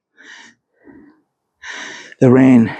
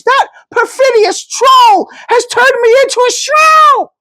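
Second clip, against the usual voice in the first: put-on much higher shouting old woman, outraged; shrieking shrew; 4 dB louder than usual. A narrator's reading. The cursed narrator rails at the troll, shrieking. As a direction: screech the accusation like a furious crone. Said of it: "I shrieked in my new voice".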